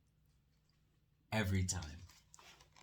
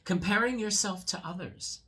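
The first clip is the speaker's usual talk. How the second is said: higher, louder answer; wise mole; 9 semitones higher, 9 dB louder than usual. Acted. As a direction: give the wise answer brightly. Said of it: "said the mole".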